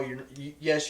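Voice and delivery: usual speaking voice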